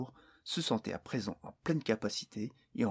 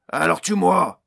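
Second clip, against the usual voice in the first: much louder, higher raging outburst; flaring up; 7 semitones higher, 14 dB louder than usual. A narrator's reading. CEO losing his temper at Barnes, raging outburst. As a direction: explode at him, daring him. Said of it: "s'emporta le P D G".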